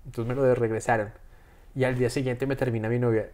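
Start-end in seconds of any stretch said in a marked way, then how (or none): none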